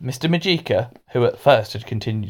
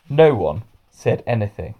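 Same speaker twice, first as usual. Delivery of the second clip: ordinary delivery, nothing marked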